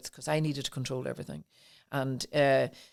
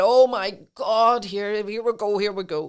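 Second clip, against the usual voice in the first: inner cry; 5 semitones higher, 7 dB louder than usual. Retold or imitated. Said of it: "my soul cried out and went".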